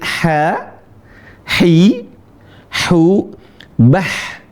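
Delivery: "ha, hi, hu, bah" with airy throat h; ha seperti kepedesan. Shown as a demonstration. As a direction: breathe each h out as if the mouth burns from chilli. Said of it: "Seperti orang kepedesan". ("ha, hi, hu, bah" dengan ha tipis dari tenggorokan)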